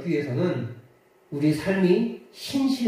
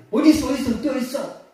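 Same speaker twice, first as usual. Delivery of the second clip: alarmed villagers; 9 semitones higher, much faster than usual. Acted.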